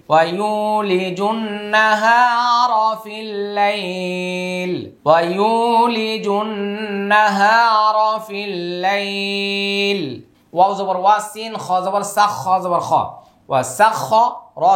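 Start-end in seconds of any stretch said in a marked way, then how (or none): 0.1-10.0 melodic chant with stretched syllables; tajweed recitation with a long drawn ending; Quran recitation. Acted. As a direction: intone it, holding nasals and long vowels.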